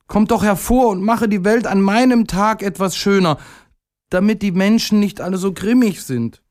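high, earnest little-character plea; high-pitched begging; pleading call. A narrator's reading. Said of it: "rief er".

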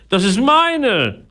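high, drawn-out protest; indignant cry. A narrator's reading. Philipp protests to greedy parrot, indignant high cry. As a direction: claim it back in outrage, slowly stretched. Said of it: "rief Philipp empört".

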